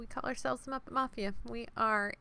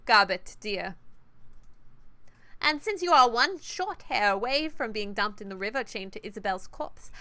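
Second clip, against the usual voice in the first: cold British menace to a scolded underling; British accent; 2 semitones higher, 7 dB louder than usual. Acted. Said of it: "oh, British accent".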